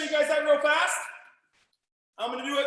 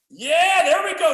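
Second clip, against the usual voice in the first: loud praise; 8 dB louder, slower than usual. Real, not acted. Coach praising a student loudly.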